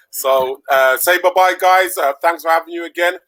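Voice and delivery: usual speaking voice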